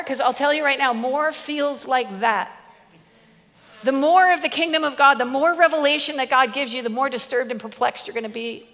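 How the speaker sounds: usual speaking voice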